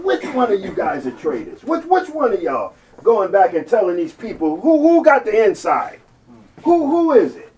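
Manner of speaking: loud, high furious demand; angry king. Acted. King shouting at his officers to find the traitor, furious. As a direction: rage at your own men.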